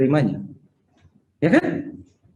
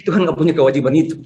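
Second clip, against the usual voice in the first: ordinary talk, nothing marked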